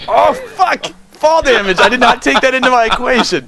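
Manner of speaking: high loud outraged shouting; yelling while running away. Real, not acted. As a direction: shout in real disbelief. Realises fall damage, yelling.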